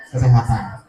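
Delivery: usual speaking voice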